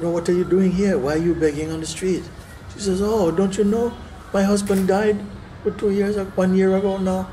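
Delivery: put-on high plaintive old woman; widow's voice. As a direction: tell the sad story as the widow. Widow explains begging, high voice.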